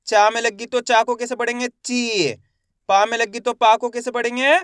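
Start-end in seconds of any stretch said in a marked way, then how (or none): 1.7-2.3 ची with a long held ee; stretched pronunciation. Shown as a demonstration. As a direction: stretch the vowel of ची, falling at the end. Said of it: "मैं इसका खींच के उच्चारण कर रहा हूँ".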